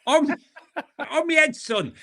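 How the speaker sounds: light stage Irish, high and laughing; mock Irish laugh line. Keeping the Irish bit going with a friend, high laughing stage Irish. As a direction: laugh through a jokey Irish phrase. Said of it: "we're doing it again".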